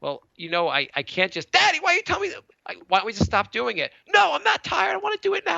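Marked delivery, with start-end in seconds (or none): 1.5-3.9 put-on high whiny child voice begging to stop; whiny little kid. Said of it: "he's just like". 4.1-5.6 put-on high shouted child protest; kid's protest shout. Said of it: "he's just like".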